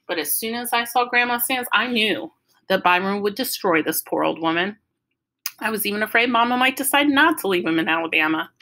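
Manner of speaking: ordinary delivery, nothing marked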